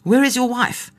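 a guide losing his diplomacy, lightly played; alarmed question. A narrator's reading. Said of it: "now losing his powers of diplomacy".

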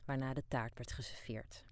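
ordinary delivery

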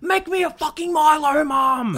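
high, loud shouted demand; imitated screaming demand. Friend screaming at his mum for a Milo, high and loud. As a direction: bark the order like a spoiled kid. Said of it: "He was like".

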